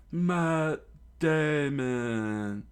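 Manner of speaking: very slow, high, loud strange chant; Mister Four's battle cry. Acted. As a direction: drag each word out as a cartoon villain. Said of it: "screamed out with every hit".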